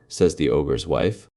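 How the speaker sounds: ordinary delivery, nothing marked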